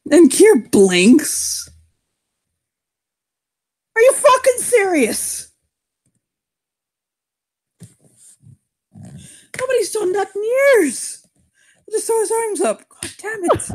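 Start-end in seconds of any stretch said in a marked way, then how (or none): 4.0-13.7 exasperated outburst. Acted. Kier exasperated at challenge.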